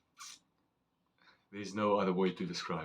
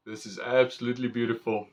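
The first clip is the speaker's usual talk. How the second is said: ordinary talk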